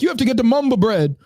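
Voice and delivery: high, garbled mock Caribbean voice; imitated Caribbean accent. Deli worker tells baffled customer, imitated Caribbean accent. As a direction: mimic a hard-to-follow Caribbean accent. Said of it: "this is the way she said it".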